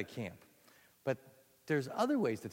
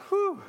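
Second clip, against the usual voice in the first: breathy relieved whew; 6 dB louder than usual. Real, not acted. Out of breath after song.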